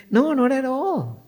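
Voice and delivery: high, lilting smiled denial; light, high-pitched retold reassurance; smiling reply. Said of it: "Then I smiled and I said".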